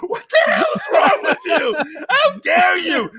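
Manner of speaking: high, shouted mock fury; mock outrage. Mock-scolding his cohost in a high outraged shout. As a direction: scold him in a squealing rage.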